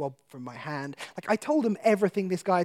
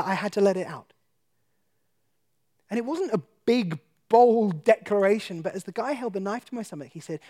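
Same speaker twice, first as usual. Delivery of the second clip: ordinary delivery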